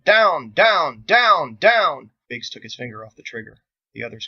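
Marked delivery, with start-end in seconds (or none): 0.0-2.1 loud, high repeated shouted warning; shouting. Acted. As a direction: bellow it to stop the shot. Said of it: "Andelin shouted".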